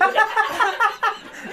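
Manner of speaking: trying hard not to laugh; stifled giggles. Real, not acted. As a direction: giggle held back through the nose. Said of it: "That was me trying not to laugh".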